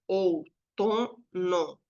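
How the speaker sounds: Outono as ou, to, no, slowly with gaps; syllable by syllable. Shown as a demonstration. Teacher counting the syllables of Outono, each said separately. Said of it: "Quantas sílabas tem outono?".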